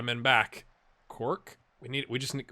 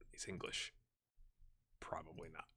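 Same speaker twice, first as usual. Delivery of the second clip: whispered to himself; 10 semitones lower, 23 dB quieter than usual. Real, not acted. Whispering to himself about the player. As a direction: drop to a breathy voiceless aside.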